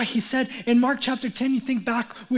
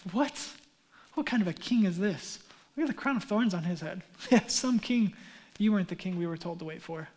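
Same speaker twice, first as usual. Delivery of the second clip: lower, softer scornful sneer; mocking disappointment; 4 semitones lower, 4 dB quieter than usual. Disappointed crowd scoffing at Jesus, low and sneering. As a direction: scoff at the dying king.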